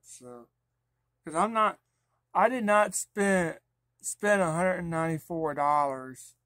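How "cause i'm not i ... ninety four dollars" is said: loud, high indignant rant; angry. Real, not acted. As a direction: insist you were cheated. Angry about wasted money.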